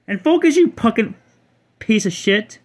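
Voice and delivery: cartoonish high-pitched mock fury; squeaky mock insult. Cursing a card's name.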